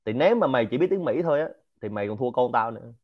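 ordinary delivery

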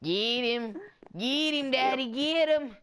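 drawled Southern cheerleading; Southern accent. Acted. Cheering on Daddy in a game, Southern accent. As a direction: egg him on in a playful Southern twang. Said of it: "That's my southern accent".

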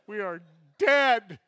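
slow, loud drawn-out dread; mock-dramatic doom; doomed declaration. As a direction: stretch it out like your fate is sealed. Knowing they're in trouble.

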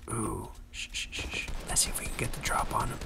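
stealthy hushed aside; hushed sneaking. Pretend. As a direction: hush it as you creep up. Sneaking up on another player.

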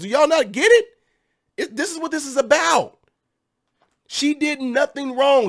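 high, mock-outraged sarcasm; sarcastic. Vlogger mocking critics, sarcastic outrage. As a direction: play the indignant defender, dripping irony. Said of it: "Can y'all tell I'm being sarcastic".

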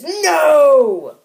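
a big, high, loud "No!"; dramatic no. Acted. Random speech bubble yelling a melodramatic refusal. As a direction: throw the no out like a melodrama. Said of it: "That was a really dramatic no".

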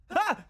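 short, very high scream; startled yelp. Real, not acted. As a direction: yelp in fright. Screams at a scare.

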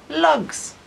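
"lugs" in a broad Ulster Scots voice, high and falling; Ulster Scots word. Shown as a demonstration. Artist teaching viewers the word "lugs" in a broad Ulster Scots voice.

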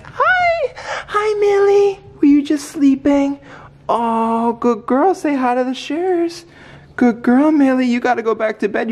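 high, excited coo to a puppy. Real, not acted. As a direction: gush over the puppy in a high voice.

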